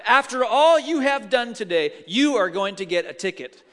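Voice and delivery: loud, emphatic imitation of a stern officer. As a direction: lay down the law, building in volume. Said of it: "officer says very sternly".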